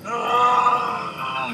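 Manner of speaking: sudden high anguished cry. Retold or imitated. Storyteller reliving the storm vision, anguished high cry. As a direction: let out a sharp high wail of terror. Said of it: "I just went".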